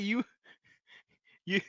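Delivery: ordinary talk, nothing marked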